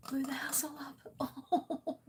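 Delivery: laughing through the words. Real, not acted. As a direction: laugh while telling it. Laughing at viewer story.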